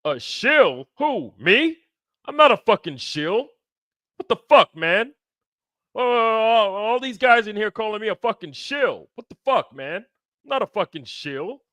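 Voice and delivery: high, loud, indignant squawk of a friend; exaggerated imitation of an offended buddy; mock outraged rant. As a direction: ham up his outrage at being called a shill. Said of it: "He's like".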